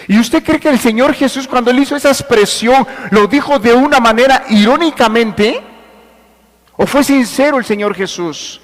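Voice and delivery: high, loud rhetorical question; forceful preaching. Real, not acted. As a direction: challenge the congregation from the pulpit. Preaches with raised voice.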